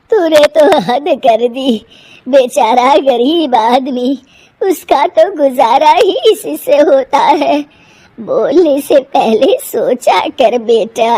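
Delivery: put-on an elderly mother chiding her daughter-in-law; old mother-in-law, slow reproach.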